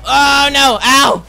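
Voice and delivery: high, loud yelps; alarmed outcry. Real, not acted. Gamer getting shot and nearly dying, alarmed. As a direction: cry out as the hits land.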